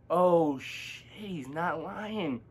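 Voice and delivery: very soft, slow, stunned aside; hushed realization. Retold or imitated. Seeing the friend's missing belt, hushed realization. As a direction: let it sink in, almost under the breath.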